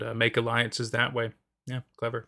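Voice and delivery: usual speaking voice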